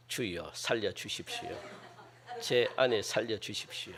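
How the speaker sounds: very soft, low, restrained plea; half-hearted hushed begging; mock quiet prayer. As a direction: pray for a dying wife far too calmly, barely audible. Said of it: "이렇게 기도하는 사람 못 봤다고요".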